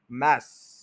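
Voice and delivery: मैस hit hard and low; full stress. Shown as a demonstration. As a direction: punch मैस with full energy. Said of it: "पूरा stress करें".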